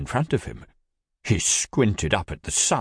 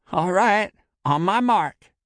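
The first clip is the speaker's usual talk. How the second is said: louder, serious and focused; 12 semitones higher, 5 dB louder than usual. A narrator's reading. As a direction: get serious, take command. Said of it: "in a more serious tone".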